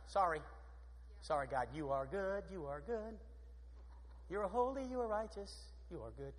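hushed, apologetic prayer; humble prayer. Acted. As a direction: pray it softly, trailing almost to a murmur. Acted humbled prayer to God.

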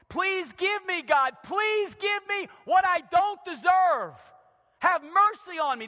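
loud, high pleading; crying out. Acted. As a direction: beg God at full preaching volume. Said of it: "David cries out".

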